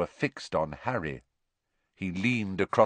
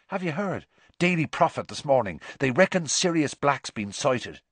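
excited Seamus; 6 semitones higher than usual. Acted.

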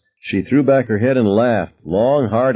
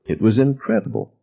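plain reading voice

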